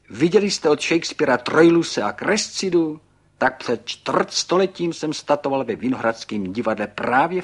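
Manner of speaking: high, loud, animated storyteller; excited Pan Burgán. A narrator's reading. Pan Burgán recalls posing on stage, excited and loud. Narrated figure tells his story.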